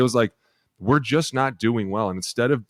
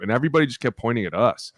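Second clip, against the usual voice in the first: ordinary talk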